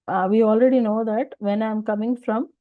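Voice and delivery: ordinary talk, nothing marked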